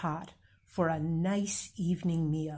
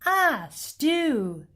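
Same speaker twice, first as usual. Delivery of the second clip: high, louder, dreamy ah; delighted officer; 8 semitones higher, 5 dB louder than usual. Acted. Hungry officer savors the stew, high and delighted. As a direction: melt at the word stew. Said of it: "she said".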